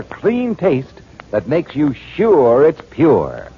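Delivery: ordinary talk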